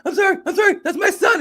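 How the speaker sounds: high-pitched, gushing mom apology; apologizing mother. Retold or imitated. Fighter's mother apologizing to the reporter, high-pitched and gushing. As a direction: squeal the sorry like a thrilled mother. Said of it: "and I'm like, he goes".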